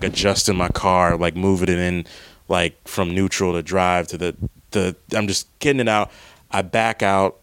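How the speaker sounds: normal talking voice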